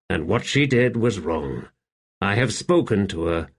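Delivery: ordinary delivery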